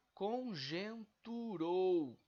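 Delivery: conjunturou very slow and loud, con-jun-tu-rou; syllable by syllable. Shown as a demonstration. Teacher demonstrating a diphthong, conjunturou slowly syllabified with rou as one. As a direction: stretch each syllable of conjunturou, keeping rou whole. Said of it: "sendo pronunciado em uma emissão só".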